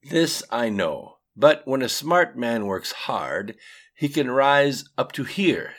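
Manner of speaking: put-on deliberate Russian-accented lecture. A narrator's reading. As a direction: measure out each word, Russian-accented. Atium's accented dialogue.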